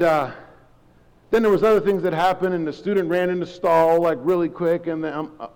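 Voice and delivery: ordinary talk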